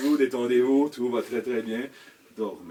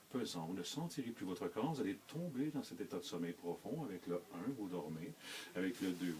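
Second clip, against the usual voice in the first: hypnotic induction; 2 semitones lower, 8 dB quieter than usual. Acted. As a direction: lull them steadily down into sleep. Leads a stage hypnosis induction.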